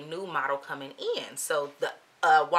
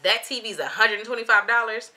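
incredulous; 5 semitones higher, 5 dB louder than usual. Retold or imitated. Disbelieving reply to the price.

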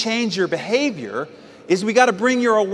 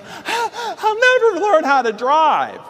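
mock despair; 9 semitones higher, slower than usual. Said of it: "You're like".

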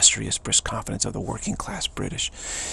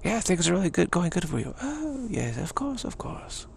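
high-pitched, airy British aristocrat; aristocracy; 7 semitones higher than usual. Acted. Aristocrat confirming things go well, airily assured. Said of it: "the aristocracy says".

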